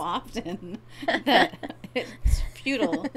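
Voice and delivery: laughing through words; slow, giggling. Real, not acted.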